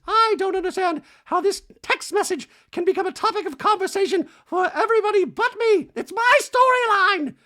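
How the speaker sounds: very high, loud mock Dorit shriek; a hammed-up Dorit, outraged. Recapping Dorit's complaint in a very high shrieking mock voice.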